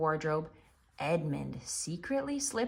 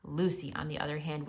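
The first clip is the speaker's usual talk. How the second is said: ordinary delivery, nothing marked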